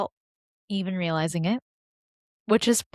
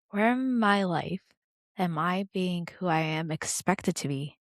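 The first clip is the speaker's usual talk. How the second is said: calm grounding exercise. Acted. Leads a breathing exercise.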